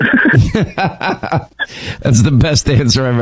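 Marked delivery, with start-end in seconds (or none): 0.0-1.5 laughing through the word. Real, not acted. Host cracks up at the caller's hillbilly answer, laughing. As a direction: breaks up laughing mid-word.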